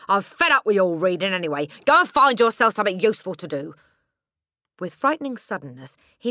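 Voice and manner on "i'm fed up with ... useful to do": loud, higher, fast scolding; angry yelling father. A narrator's reading. Father ordering Matilda away, loud angry yell. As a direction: drive her off with a shout.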